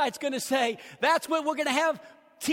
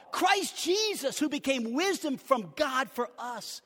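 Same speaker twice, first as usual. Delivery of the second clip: preaching; 2 semitones higher than usual. Acted. Pastor preaching to his church, fervent. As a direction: preach it to the congregation with fire.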